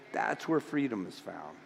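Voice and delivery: hushed repeat of the declaration; quiet echo. Real, not acted. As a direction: let it settle quietly. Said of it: "repeated quietly".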